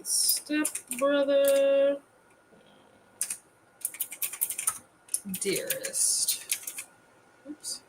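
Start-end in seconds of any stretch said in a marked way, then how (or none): 0.0-6.4 drawn out slowly, word by word as she types; very slow typed-along title; slow dictation while typing. Real, not acted. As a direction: spell it out slowly as you type. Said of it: "Forgot the R".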